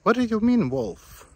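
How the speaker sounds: high, loud alarmed question; real shock at the wolf warning; startled disbelief. Real, not acted. Hiker reading a wolf warning sign, alarmed disbelief.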